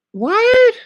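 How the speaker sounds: high, sharp disbelieving what; a stunned yelp; shocked. Real, not acted. Vlogger hearing the basketball sentence, shocked high yelp.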